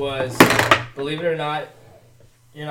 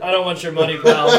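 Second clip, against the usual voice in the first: loud, raised-pitch mock refusal; mock outrage; 3 semitones higher, 10 dB louder than usual. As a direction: indignantly throw a customer out, hammed up. Mock outrage at drunk passenger.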